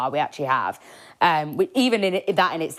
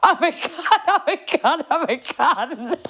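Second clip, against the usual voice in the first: high wailing, half laughing, half crying; mock-sob it between laughs; hammed-up drunk self-pity, higher and laughing; drunken laughing wail; 9 semitones higher, slower than usual. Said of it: "stroking a chicken going".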